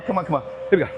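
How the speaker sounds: ordinary talk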